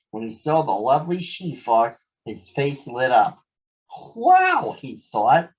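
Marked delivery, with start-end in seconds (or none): 4.1-4.7 much higher, louder gasp of wonder; amazed wow. Acted. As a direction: struck by her beauty. Todd sees the lovely she-fox.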